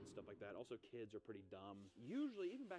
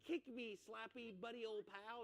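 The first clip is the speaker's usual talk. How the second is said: mock character; 11 semitones higher, slower than usual. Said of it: "He's like".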